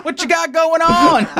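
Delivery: high, drawn-out mock segment title; mocking catchphrase. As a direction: send up a TV segment catchphrase, slowly.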